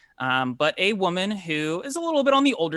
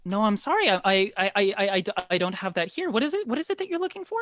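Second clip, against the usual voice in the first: high, stammering, apologetic hostess; pitched-up woman, halting then questioning; flustered baker woman; 6 semitones higher, faster than usual. Acted. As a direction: puzzle over an unknown request, pitch climbing. Game master plays baker Bella.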